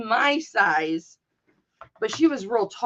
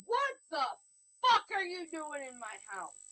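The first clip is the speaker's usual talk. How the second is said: outraged yell; 5 semitones higher, 8 dB quieter than usual. Retold or imitated. Daughter finding old bully in her house, outraged yell.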